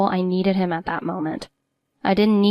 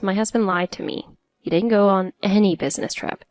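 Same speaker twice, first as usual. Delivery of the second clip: ordinary delivery, nothing marked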